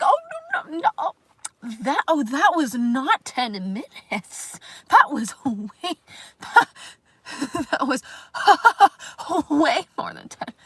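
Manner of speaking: high, loud, slow scolding in a prim British voice through laughs; tut-tut like a fussy British perfectionist; perfectionist inner voice. Retold or imitated. Voicing her inner critic scolding about the stream length, high and loud with laughter. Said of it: "perfectionistic fucking voice in my head".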